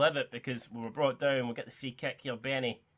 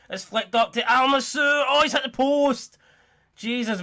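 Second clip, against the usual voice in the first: much higher and louder cry as it hits the post; excited commentary; 10 semitones higher, 8 dB louder than usual. Real, not acted. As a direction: shout it as the ball strikes the post. Shot hits the post live.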